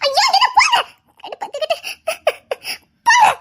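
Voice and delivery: high embarrassed laughing shout; laughing shoo. Acted. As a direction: laugh and push him away. (வெட்கச் சிரிப்புடன் கத்தல்)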